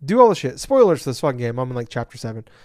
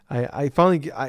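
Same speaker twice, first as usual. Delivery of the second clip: ordinary delivery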